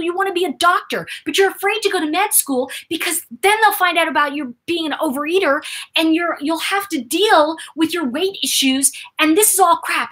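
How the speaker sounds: rising, fast-paced rant; heated. Retold or imitated. Speaker confronting friend about his overeating, heated. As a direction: lay into him, getting fired up. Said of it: "I said".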